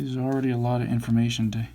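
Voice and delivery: ordinary talk, nothing marked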